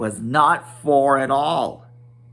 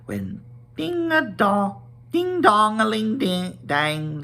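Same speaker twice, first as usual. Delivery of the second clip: doorbell chime; 8 semitones higher than usual. A narrator's reading. Imitating doorbell.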